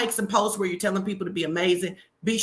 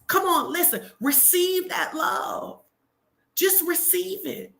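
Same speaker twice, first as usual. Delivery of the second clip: high-pitched, slow call to receive; preacher-style exhortation to the audience; high rousing call; 10 semitones higher, slower than usual. Real, not acted. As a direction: lift it up and urge them. Urging viewers to receive love.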